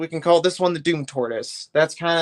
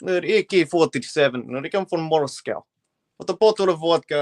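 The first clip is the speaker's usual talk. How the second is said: mock Russian accent, a touch louder; 4 dB louder than usual. Shown as a demonstration. Streamer shows off his Russian accent to chat, mock Russian. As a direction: lightly do a Russian accent, rolling through the phrase. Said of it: "I can pull off a Russian accent pretty well".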